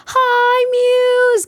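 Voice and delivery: high, loud hi to a friend; excited greeting. Real, not acted. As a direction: burst out greeting the raider. Greeting roommate who raided.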